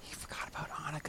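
breathy whispered aside, no voice at all; hushed teasing whisper. Real, not acted. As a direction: whisper it as a sly jab at his friend. Teasing aside about forgotten name.

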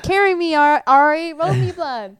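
bossy demand. Retold or imitated. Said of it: "I'm like".

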